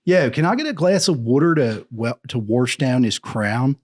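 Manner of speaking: loud, lifted Philadelphia accent; Philly accent. Shown as a demonstration. Demonstrating Philly talk to a friend, Philly accent. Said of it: "It's a weird accent in Philly".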